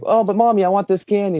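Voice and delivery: mock child pleading for candy; whiny kid. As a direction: beg like a little boy wanting candy. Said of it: "And then he's like".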